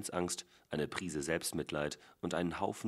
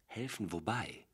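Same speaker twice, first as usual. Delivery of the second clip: puzzled question; 6 semitones higher than usual. A narrator's reading. Nathan asks back, puzzled.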